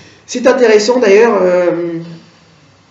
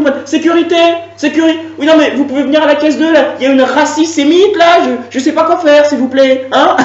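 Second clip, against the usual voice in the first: shouting for security; 6 semitones higher than usual. Acted. Cashier calls security.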